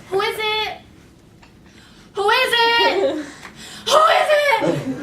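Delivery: loud, high frightened calls; calling out. Retold or imitated. Alone in the bathroom, calling out to the hallway, loud and frightened. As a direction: shout it out, scared and unsure. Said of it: "So I start calling out".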